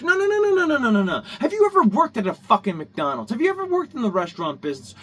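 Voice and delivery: loud, rapid-fire angry refusal; angry interruption. Real, not acted. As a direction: cut them off loudly, fired up. Angry rant cutting off imagined objection.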